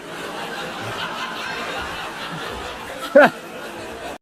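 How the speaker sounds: long scornful laugh after the insult; a drawn-out mocking laugh at Groovy Jimmy. Real, not acted. Laughs at his rival.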